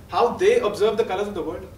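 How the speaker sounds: ordinary talk, nothing marked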